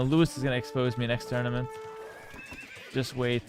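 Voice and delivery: ordinary talk, nothing marked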